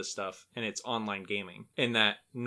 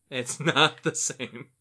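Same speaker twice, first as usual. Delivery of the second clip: laughing; 2 semitones higher, 4 dB louder, slower than usual. Real, not acted. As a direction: laugh breaks into the line. Repeats it, laughing through it.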